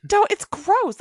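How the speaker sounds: high, loud laughing protest; laughing disgust. Real, not acted. Cohost laughing at the ring hidden in pudding, grossed out.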